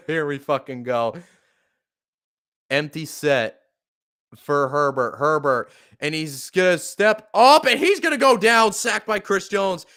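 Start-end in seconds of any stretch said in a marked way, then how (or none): 6.0-9.8 climbing higher and louder as the sack hits; rising alarm. Real, not acted. Fan calling the Chargers quarterback getting sacked, building alarm. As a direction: call the sack as it unfolds, voice jumping up.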